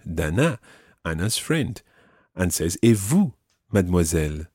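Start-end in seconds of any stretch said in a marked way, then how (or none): none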